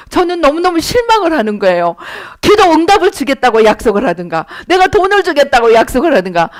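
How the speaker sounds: ordinary talk, nothing marked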